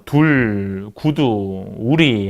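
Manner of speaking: '둘, 구두, 우리'의 '우' 모음을 길게 늘여서; '둘, 구두, 우리'를 낮고 느리게, '우' 소리를 들려주듯. Shown as a demonstration. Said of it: "요런 소리들을 냅니다". ('둘, 구두, 우리'의 '우' 소리를 또렷이 길게 시범)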